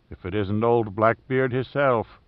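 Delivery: usual speaking voice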